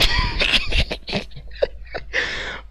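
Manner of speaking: laugh. Real, not acted. Laughing at final quarter score.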